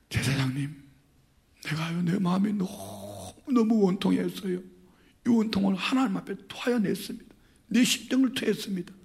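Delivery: softly, meekly pleading to the priest; a humble woman quietly explaining her grief; humble soft answer. Retold or imitated. Hannah humbly and softly answers the priest.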